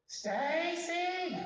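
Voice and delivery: high drawn-out call for Stacy. Retold or imitated. Mother calling out at night.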